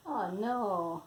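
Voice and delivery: plain reading voice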